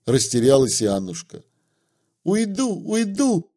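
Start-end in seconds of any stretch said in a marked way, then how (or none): 2.2-3.5 put-on high, squealing girl's cry. A narrator's reading. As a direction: squeal it out in panic. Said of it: "повизгивала она".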